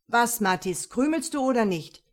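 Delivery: loud, challenging comeback; loud retort. Acted. Mathis fires back at Emil, loud and challenging. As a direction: throw the question back in his face.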